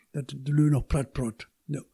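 usual speaking voice

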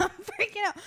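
high-pitched imitation of someone panicking; mock panic. Listener mimics friend's hospital freak-out, mock panic. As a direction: put on a squeaky-high panicked voice. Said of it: "and I'm like".

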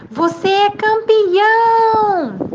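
high, drawn-out loud call; proud shout. A narrator's reading. Father calling praise to his son, slow high shout. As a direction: holler it slowly, holding the last word. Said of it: "de novo gritou".

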